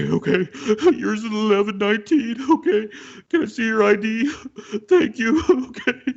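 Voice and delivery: very high, blubbering waitress impression; openly weeping, imitated. Waitress ringing up a customer, imitated open weeping. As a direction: mimic sobbing through each polite phrase. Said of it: "somebody who was openly weeping".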